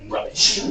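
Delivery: "rubbish" breathed out, savoured; savoured word. Shown as a demonstration. Teacher savouring "rubbish" for her class. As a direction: whisper "rubbish" fondly. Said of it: "that's my favorite word".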